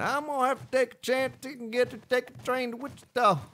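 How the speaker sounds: high-pitched country twang; put-on country singsong, pitched high; exaggerated country accent. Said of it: "I said that with a country accent".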